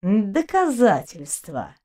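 slow, stretched and biting; a slow, acid drawl; caustic drawl. A narrator's reading. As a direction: drag the word out with sarcasm. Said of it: "едко протянул доктор".

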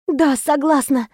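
high, slow sighed consent; breathed out. A narrator's reading. Woman consenting to marry her lover, breathing it out. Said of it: "выдохнула я".

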